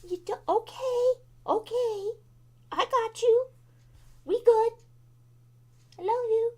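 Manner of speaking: high tender voice soothing a dog; soothing pet talk. Real, not acted. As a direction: reassure the puppy, sweet and high.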